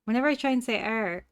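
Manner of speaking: usual speaking voice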